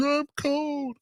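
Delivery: put-on high, whiny little girl voice; whiny child. Dad voicing his daughter on the walk, high whiny child. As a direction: whimper it like a cold four-year-old.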